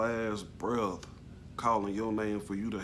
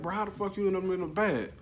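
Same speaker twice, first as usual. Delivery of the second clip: shocked disbelief; 10 semitones higher than usual. Retold or imitated. Shocked he's in the back.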